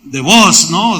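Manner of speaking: ordinary talk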